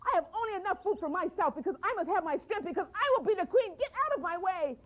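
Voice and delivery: put-on greedy girl boasting she will be queen; haughty girl. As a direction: push past him, full of herself. Greedy girl refuses the boy.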